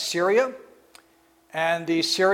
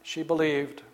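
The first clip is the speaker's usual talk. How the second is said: a softer, lower echo of she believed; hushed repeat; 3 semitones lower, 8 dB quieter than usual. Acted. Preacher echoing Mary's faith to the congregation, softly. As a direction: let it land gently.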